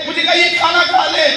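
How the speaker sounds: normal talking voice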